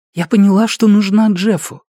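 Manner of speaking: lightly voiced young mother; mother's voice. Mother's voice in story.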